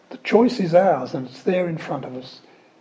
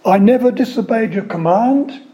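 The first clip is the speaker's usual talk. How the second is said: resentful elder son; 5 semitones higher, 7 dB louder than usual. Acted.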